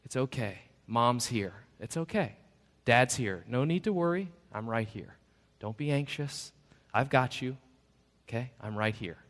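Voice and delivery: put-on soft, tender reassurance to a scared child; gently calm a frightened little one; soothing parent. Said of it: "What does the parent say?".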